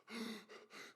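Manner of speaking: sharp unvoiced gasp as if surfacing from water; gasp for air. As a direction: suck in air like a boy pulled up from drowning. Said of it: "he's gasping for air".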